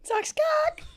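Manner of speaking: squeaky high repeat; high squeaky echo. Pretend. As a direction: squeak it back. Echo of the punchline.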